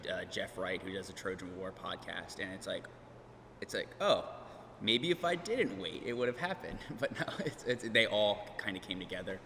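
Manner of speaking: usual speaking voice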